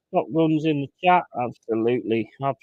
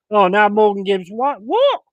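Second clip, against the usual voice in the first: higher, loud, rising what; stunned by the ref; indignant cry; outraged disbelief; 4 semitones higher, 8 dB louder than usual. Real, not acted. Fan shocked at referee call.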